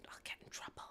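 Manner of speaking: unvoiced whisper, barely there. Real, not acted. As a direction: whisper so nobody hears. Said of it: "I can't say it too loud".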